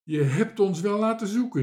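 loud, high scolding; angry call. A narrator's reading. Marinka to the sailor found in the tree, angrily. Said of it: "riep Marinka boos".

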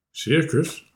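plain reading voice

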